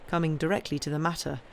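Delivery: usual speaking voice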